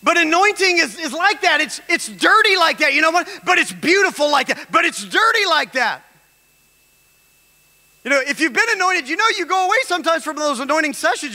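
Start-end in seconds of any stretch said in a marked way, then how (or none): 0.0-3.3 rising preaching voice. Acted. 3.4-4.7 preaching shout. Acted. Preacher telling the church anointing is beautiful, ringing shout. 8.0-11.3 rising preaching voice. Acted.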